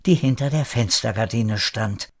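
ordinary delivery, nothing marked

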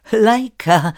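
laut und hoch gerufen; lauter Ruf. Acted. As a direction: ruf den Hund herbei. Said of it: "rief sie". (Alicia ruft die Hündin Laika laut)

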